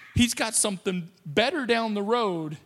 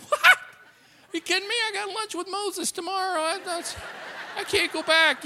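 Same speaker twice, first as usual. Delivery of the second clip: much higher, laughing disbelief; protesting Lazarus; 10 semitones higher than usual. Retold or imitated. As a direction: squeal like a man losing his lunch plans. Lazarus protests being sent back.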